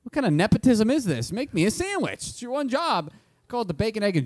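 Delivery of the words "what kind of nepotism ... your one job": loud, high-pitched exasperated rant. Retold or imitated. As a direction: demand it like a fed-up customer. Exasperated complaint to sandwich maker.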